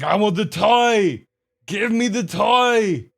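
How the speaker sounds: loud, high, drawn-out action-hero bellow; Arnold. Acted. Owner voices the husky demanding a toy, hammed-up Arnold voice. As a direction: bellow slowly in a hammed-up accent. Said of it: "It's the Arnold voice".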